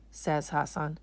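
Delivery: ordinary delivery, nothing marked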